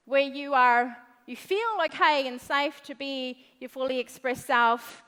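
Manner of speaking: normal talking voice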